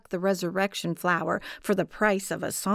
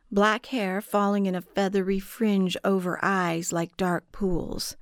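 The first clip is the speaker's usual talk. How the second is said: ordinary delivery, nothing marked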